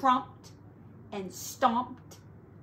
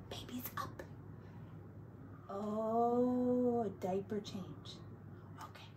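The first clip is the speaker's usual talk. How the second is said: whisper; 8 dB quieter than usual. Real, not acted. Whispering at bedtime.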